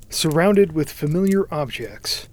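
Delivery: usual speaking voice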